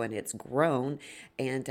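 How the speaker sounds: usual speaking voice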